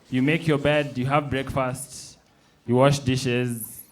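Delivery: ordinary talk, nothing marked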